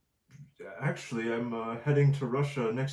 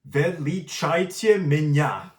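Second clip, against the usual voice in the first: "Величайте меня" slow and louder; proud attempt; 4 semitones higher, 8 dB louder than usual. Real, not acted. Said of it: "that's so good".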